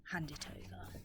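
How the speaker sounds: low, hushed rat's order. Acted. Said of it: "but he ordered".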